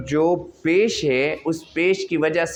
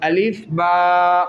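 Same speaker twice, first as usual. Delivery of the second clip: recitation drill; much slower than usual. Shown as a demonstration. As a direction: call out each letter slowly and clearly. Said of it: "एक एक ग्राम का वज़न".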